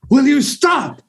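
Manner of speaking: high, exasperated movie-quote holler; hammed-up high-pitched outburst; quoted Scrooged line. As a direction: act out a favourite film line. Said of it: "the line that we always do from Scrooged".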